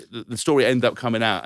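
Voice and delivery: ordinary delivery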